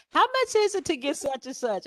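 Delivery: high-pitched caller asking the price; mock caller. Client phoning the photographer, a high mock caller voice.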